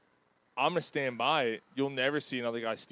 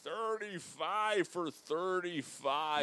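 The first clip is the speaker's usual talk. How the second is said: drawn out; 3 semitones higher, 5 dB quieter, much slower than usual. Pretend. Savoring the stat.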